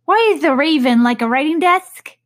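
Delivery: high, loud, odd riddle voice; mad Hatter voice. Acted. The Hatter poses his riddle to Alice, high and odd.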